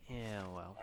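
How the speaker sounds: quiet grumbled aside; muttered. Real, not acted. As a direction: say it softly to yourself, a little annoyed. Grumbling at the game.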